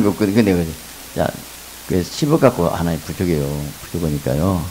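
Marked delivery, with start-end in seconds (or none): none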